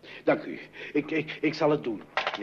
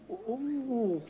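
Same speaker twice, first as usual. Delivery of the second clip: surprised; 9 semitones higher, slower than usual. Acted. Father surprised by visitor on phone.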